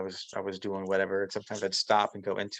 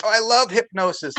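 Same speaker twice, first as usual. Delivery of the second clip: high, loud gush of delight; light up at the word hypnosis; enthusiastic; 11 semitones higher, 7 dB louder than usual. Retold or imitated. Coworker telling the speaker he loves hypnosis, high eager gush.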